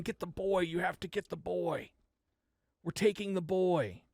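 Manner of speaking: high eerie chant; menacing crows demanding; crow voices. Acted.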